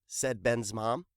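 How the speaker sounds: usual speaking voice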